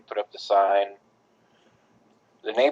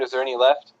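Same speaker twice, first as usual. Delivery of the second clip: ordinary talk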